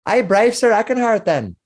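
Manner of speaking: chirpy tavern landlady voice; bubbly barmaid. Acted. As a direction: greet the bard like a delighted hostess.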